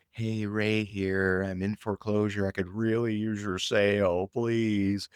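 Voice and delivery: mock pleading salesman, drawn out; desperate and needy. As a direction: parody a needy sales plea, dragging the words. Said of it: "Being desperate, needy".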